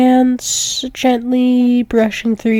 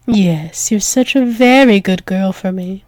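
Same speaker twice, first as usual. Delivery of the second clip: ordinary delivery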